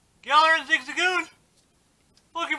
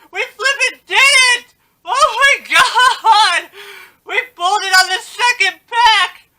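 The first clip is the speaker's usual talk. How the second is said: tearful joyful shouting, much louder; half-crying, half-cheering with joy; giddy relief; 7 semitones higher, 9 dB louder than usual. Real, not acted. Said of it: "calm down, [name]".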